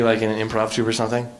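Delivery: ordinary talk, nothing marked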